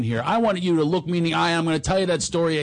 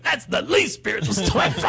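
imitated indignant objection, pitched up; hammed-up dismissal; mock yelling; 4 semitones higher than usual. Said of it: "He starts yelling at me".

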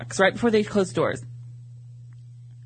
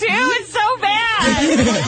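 high, drawn-out wail of disgust; stretched, pitched-up protest through laughter; amused revulsion; 9 semitones higher, 4 dB louder, much slower than usual. Real, not acted. Guest heckling host's impression, loudly appalled.

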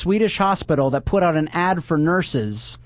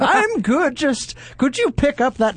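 much higher, slow put-on old man voice; mock old man; 9 semitones higher, slower than usual. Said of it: "woman will come up and be like".

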